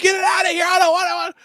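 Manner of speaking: put-on frantic high shout of a scared boy; panicked child shouting. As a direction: scream it like a terrified kid wanting the thing gone. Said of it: "He's like".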